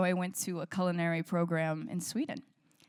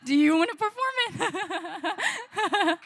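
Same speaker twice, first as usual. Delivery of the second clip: a slow, laughing question, pitched higher and louder; laughing invitation; 12 semitones higher, 6 dB louder, much slower than usual. Real, not acted. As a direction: laugh through a teasing offer to the audience. Jokingly offers audience member the song.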